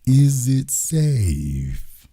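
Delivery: very slow, eerie echo of "Is it safe?"; Marathon Man quote. Retold or imitated. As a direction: stretch each word, haunting. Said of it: "Dustin Hoffman in The Marathon Man".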